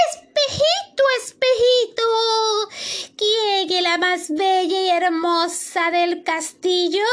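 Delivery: reina agudísima y lenta, hablándole al espejo; voz de la reina. Acted. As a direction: pregunta vanidosa con voz muy alta y estirada. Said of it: "le preguntaba". (la reina pregunta a su espejo mágico, voz aguda y lenta)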